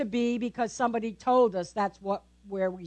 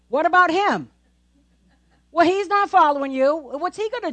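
Peter's protest; 6 semitones higher, 7 dB louder than usual. Acted. Pastor voicing Peter's protest.

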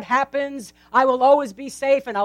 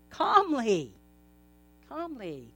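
prepare calmly said slow and much softer; softly calm; 10 dB quieter than usual. Shown as a demonstration. Pastor modelling calmness in the storm for the congregation. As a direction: ease down into a quiet hush.

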